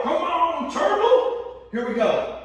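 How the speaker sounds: high loud encouragement; coaching cheer. Real, not acted. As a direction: pump up the class.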